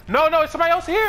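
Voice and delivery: alarmed. Real, not acted. Sudden alarm mid fight.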